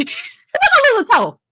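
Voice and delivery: hammed-up panicked squeak; mock panic. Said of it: "Then I'm like".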